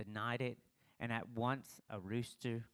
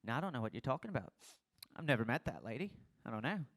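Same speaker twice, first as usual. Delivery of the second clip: fast nervous brush-off; rushed, panicky lie; flustered rushed denial; faster than usual. Pretend. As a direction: gabble the denial to get away.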